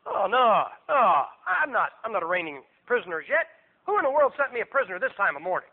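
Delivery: groaning, grumbling riser; hammed-up groggy moaning and grousing; just-woken Herod. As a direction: act dragged out of sleep, complaining. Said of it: "And Herod probably wakes up".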